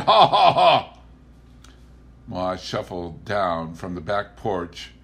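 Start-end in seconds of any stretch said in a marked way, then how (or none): 0.1-1.0 loud high ha-ha laugh; mocking laugh. A narrator's reading. Elwin laughing at Pa.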